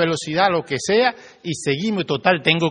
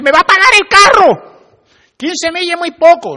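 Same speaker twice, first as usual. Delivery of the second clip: much higher, much louder mock complaint; outraged whine; 13 dB louder than usual. Drivers complaining about school-zone limit.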